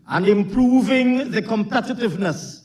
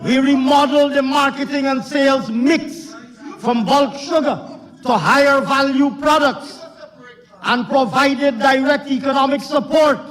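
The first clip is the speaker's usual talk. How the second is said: raised, emphatic political declaiming; forceful parliamentary oratory; 5 semitones higher, 3 dB louder than usual. Real, not acted. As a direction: project each phrase across a noisy chamber.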